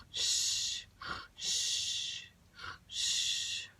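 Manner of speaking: soft breathy "shh" snore; sleeping bear's whistleless snore; snoring sound. Pretend. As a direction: snore out long, hushed exhales. Bear snoring in the story.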